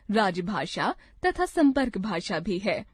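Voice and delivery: ordinary talk, nothing marked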